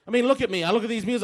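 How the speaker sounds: ordinary talk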